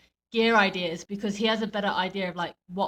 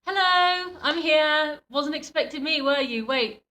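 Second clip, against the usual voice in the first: cocky entrance voice; 7 semitones higher than usual. Retold or imitated. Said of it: "You know, just like".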